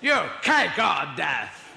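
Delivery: slow booming shout. Retold or imitated. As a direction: boom it slowly and menacingly. Inquisitor demands choice.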